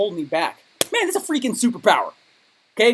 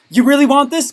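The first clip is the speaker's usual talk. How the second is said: tempting voice; 7 semitones higher, 8 dB louder than usual. Retold or imitated. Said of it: "people around you will be like".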